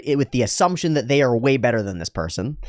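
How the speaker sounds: ordinary talk, nothing marked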